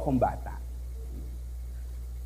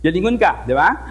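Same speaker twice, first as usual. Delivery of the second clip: usual speaking voice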